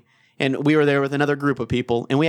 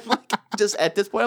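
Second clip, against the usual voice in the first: usual speaking voice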